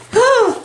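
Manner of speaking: sharp high gasp; excited gasp. Real, not acted. Seeing the next gift, excited gasp. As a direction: gasp in delighted surprise.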